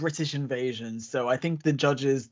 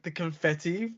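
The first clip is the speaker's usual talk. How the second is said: ordinary talk, nothing marked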